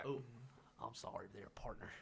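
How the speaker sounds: hushed mock cowboy.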